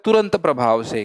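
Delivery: ordinary talk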